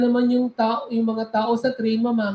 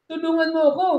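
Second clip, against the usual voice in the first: shouting for help; 6 semitones higher than usual. Acted.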